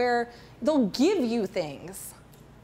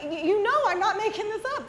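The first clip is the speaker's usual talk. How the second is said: laughing; 9 semitones higher, faster than usual. Real, not acted. Laughing assurance to audience.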